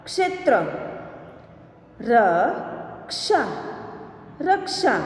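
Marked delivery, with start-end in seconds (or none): 2.0-3.4 slow, each sound drawn out, rising at the end; slow drawn-out reading. Shown as a demonstration. Teacher slowly sounding out a word for students, drawn out. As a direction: stretch the word slowly for the class. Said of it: "अब इसका शब्द हम देखें".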